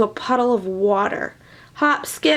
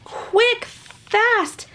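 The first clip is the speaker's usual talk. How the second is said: high, urgent calls; excited hurry; 9 semitones higher than usual. Acted. Reader urging the leprechaun, high and eager.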